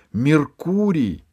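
high, drawn-out call of the name; shouted name. A narrator's reading. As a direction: call out to him across the clearing. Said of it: "закричала Алиса".

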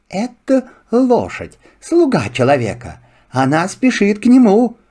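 high, lively fox. Acted. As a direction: chatter like a sly fox. Fox speaks. (голос лисы)

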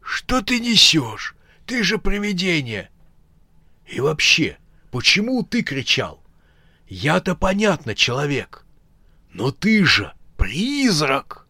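high, incredulous scolding; shaken uncle scolding. Acted. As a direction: disbelief at a scared ghost. Uncle scolds frightened ghost.

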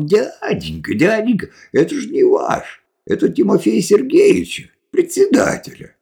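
put-on high-pitched boy piping up; high child's voice; little boy. A narrator's reading. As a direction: voice an anxious kid warning a grown-up. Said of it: "подбежал какой-то мальчишка и сказал".

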